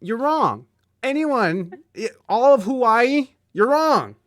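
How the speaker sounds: loud, high playful outrage; mock outrage. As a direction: shout it like a mock tantrum. Mock rant against Hawaii.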